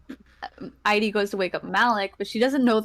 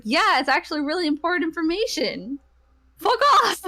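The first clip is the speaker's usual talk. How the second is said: annoyed retort; 8 semitones higher, 3 dB louder than usual. Acted. Idy snapping at woken Malik.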